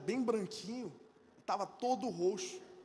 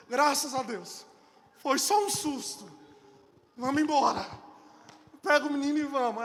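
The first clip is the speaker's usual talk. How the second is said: relieved; 3 semitones higher, 7 dB louder than usual. Retold or imitated. Relieved father to wife.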